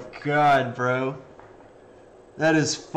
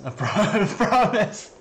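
"I promise" broken by a laugh, higher; 6 semitones higher than usual. Real, not acted. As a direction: say "I promise" while cracking up.

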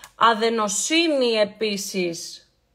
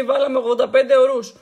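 retold claim; 8 dB louder than usual. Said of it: "λέει".

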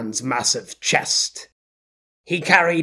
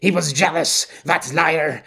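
ordinary delivery

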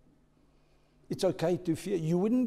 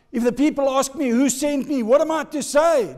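Moses pleading; 9 semitones higher, 8 dB louder than usual. Acted. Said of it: "he says to the burning bush".

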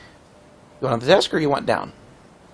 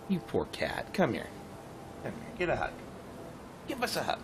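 soft cooing to cat; 16 dB quieter than usual. Real, not acted. Comforting the cat.

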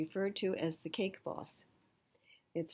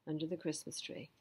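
plain reading voice

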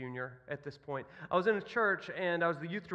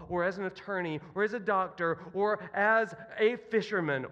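bold declaration; 4 semitones higher, 4 dB louder than usual. Acted. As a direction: declare it firmly, word by word.

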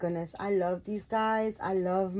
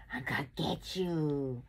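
playful monster; 3 semitones lower, 4 dB quieter than usual. Pretend. Playful monster chasing the doll.

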